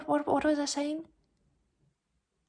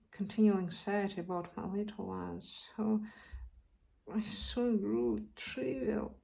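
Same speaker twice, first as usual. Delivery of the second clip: soft, subdued confession; understated, weary sadness; downhearted; 7 dB quieter than usual. Acted. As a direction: recount it quietly, spirit worn away. Actress recounts her downhearted decline.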